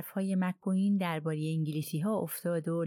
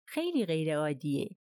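coldly. A narrator's reading. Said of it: "کلونل آرباتنات با سردی گفت".

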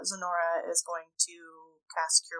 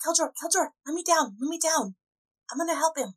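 high character voice; 9 semitones higher than usual. Acted. Halfling character pleading.

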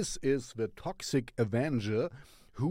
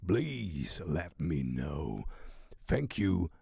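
dramatic sign-off; 8 semitones lower, 3 dB quieter than usual. Acted. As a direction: sign off low and deliberate. Low, slow sign-off to viewers.

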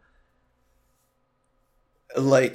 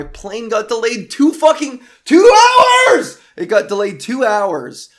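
outraged yell; 9 semitones higher, 11 dB louder than usual. Real, not acted. Vlogger to listeners about the airline, breaking into a high yell. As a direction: voice cracking up into a loud yell. Ranting about a delayed flight.

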